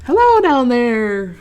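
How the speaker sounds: loud, slow squirrel call from above. A narrator's reading. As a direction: call down from a tree branch. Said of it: "called the squirrel".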